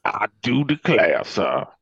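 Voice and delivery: loud, put-on Louisiana "I do declare"; mock Southern accent. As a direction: do a hammy Southern gentleman accent. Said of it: "trying to do, like, a Louisiana accent".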